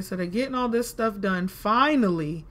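usual speaking voice

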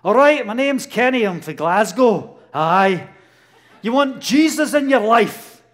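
loud, thick Glaswegian brogue; loud, rough old Glasgow accent. Retold or imitated. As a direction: blast it out in heavy Glasgow Scots. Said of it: "I didn't speak like this back then".

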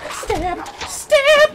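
squealed very high and loud; high-pitched stab. Shown as a demonstration. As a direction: shriek it way up high. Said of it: "I can still do the high-pitched ones".

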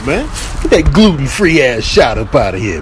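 ordinary talk, nothing marked

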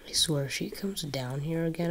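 hushed conspiratorial whisper. Real, not acted. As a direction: whisper while hiding. Hiding, plotting in game.

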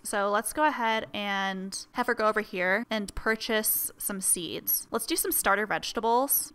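ordinary delivery

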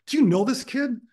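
high-pitched, indignant retold question; mock indignation. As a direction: imitate an irked professor demanding confirmation. Irritated professor questions secretary, retold.

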